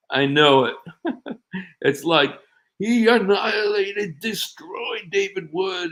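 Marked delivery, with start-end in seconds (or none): 2.8-5.9 high, slow, drawn-out mock triumph; mock boasting. Mocking fans who claim their debater won, boastful. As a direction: imitate a gloating fan, stretching the words up high. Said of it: "some people like to say".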